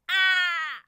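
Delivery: a high alarmed yelp; startled cry. Acted. Narrator yelping as the cart appears. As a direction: yelp in sudden fright. Sudden danger of shopping cart.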